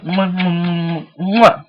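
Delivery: blown kisses, loud and high; between kisses. Real, not acted. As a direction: smack kisses at the camera. Blowing kisses at sign-off.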